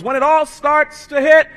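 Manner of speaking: loud, slow shouted climax; full-force pulpit shout; shouting preacher. Acted. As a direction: hammer every word home.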